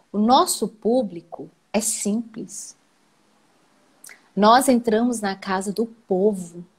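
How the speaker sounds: normal talking voice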